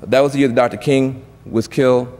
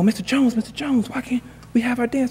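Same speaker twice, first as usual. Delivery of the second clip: high-pitched, softer pleading voice; pleading kids; 12 semitones higher, 5 dB quieter than usual. Retold or imitated. Kids asking Mister Jones for their dance, pleading. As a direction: imitate children begging the principal. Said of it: "the kids would be stunned".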